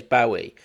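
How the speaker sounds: [name] as BOW-ee, rhyming with "Maui"; mum's mispronunciation. Retold or imitated. Host quoting his mum, [name] said BOW-ee. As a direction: say [name] with an OW as in "cow". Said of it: "my mum to this day".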